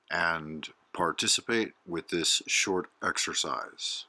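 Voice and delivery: low, softly spoken show introduction; announcer intro. Real, not acted. Host welcoming listeners to a short guided meditation, calm announcer read.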